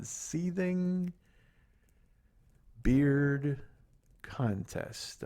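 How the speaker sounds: word by word with long pauses; dictation. Real, not acted. Host writing a phrase down on air, slow dictation. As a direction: say it slowly as you write it down. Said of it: "to hear a man do dictation".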